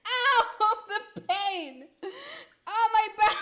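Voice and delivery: high, loud yelps through laughter; pained laughter. Real, not acted. Streamer hurting from laughing, pained yelps. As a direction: groan "ow" while cracking up.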